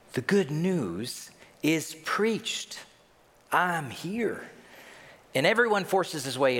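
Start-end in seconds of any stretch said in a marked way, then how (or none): 0.1-4.5 lightly voiced Jesus, drawn out; proclaiming Jesus. Preacher imagines Jesus announcing good news, slow proclamation. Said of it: "you can almost picture him going".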